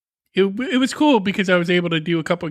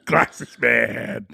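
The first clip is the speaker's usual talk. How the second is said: trailer voice; 7 semitones lower than usual. Pretend. Trailer title echo.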